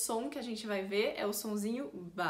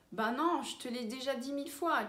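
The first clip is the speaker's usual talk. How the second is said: mildly exasperated "Bah non"; stating the obvious; 2 semitones higher than usual. Shown as a demonstration. Teacher refuses a repeated invitation, stating the obvious. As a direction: answer as if it's been said endlessly. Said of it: "falei uma coisa que era óbvia".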